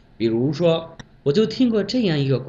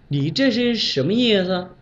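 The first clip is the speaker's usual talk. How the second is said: higher, probing boss voice; questioning boss; 6 semitones higher than usual. Retold or imitated. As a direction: ask it like a suspicious official. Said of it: "领导说：".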